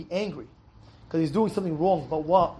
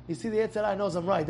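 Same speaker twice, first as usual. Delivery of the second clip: ordinary delivery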